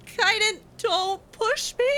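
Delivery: ordinary delivery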